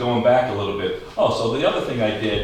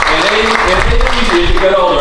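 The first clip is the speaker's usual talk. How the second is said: folksy teasing; 8 semitones higher, 10 dB louder than usual. Real, not acted. Teasing older guests, folksy.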